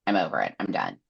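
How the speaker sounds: low, soft, dropping off; mock giving up. Amren giving up on Nesta, low and soft. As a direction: let it sink, quietly done. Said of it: "that was just like".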